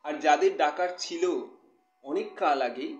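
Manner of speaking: expressive poetry reading with measured pauses; poem recitation. Acted. Poet reading a new poem to live viewers, expressive recitation. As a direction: recite it line by line, letting key words swell.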